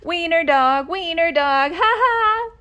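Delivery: high, loud sing-song taunt; taunting laugh. Acted. Dogs taunting Oscar as they run, laughing chant. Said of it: "laughed the other dogs".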